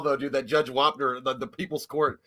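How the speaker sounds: normal talking voice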